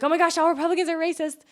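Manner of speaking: high, fast, mock-shocked liberal; mocking gasp. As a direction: parody someone gasping in outrage. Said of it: "everybody's like".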